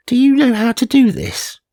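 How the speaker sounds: high, dubious friend; doubtful friend. Retold or imitated. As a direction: ask it as a skeptical friend. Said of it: "my friend Jess asked".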